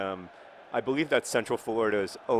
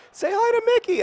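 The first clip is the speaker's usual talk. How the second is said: much higher and louder, sing-song cheer; imitating excited parents; 8 dB louder than usual. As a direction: gush like a parent urging a kid. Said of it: "my parents would always be like".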